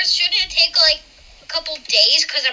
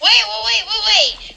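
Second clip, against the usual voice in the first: urgent protest; 8 semitones higher, slower than usual. Real, not acted. Player frantically interrupting the GM.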